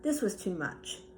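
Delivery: ordinary delivery, nothing marked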